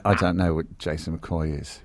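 British alter ego. Acted. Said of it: "quite a good British accent".

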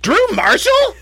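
high, squealed name in surprise; surprised recognition. Retold or imitated. Retelling how director Lydia recognized him, high surprised squeal. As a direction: imitate her shock at seeing your name. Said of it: "And she goes".

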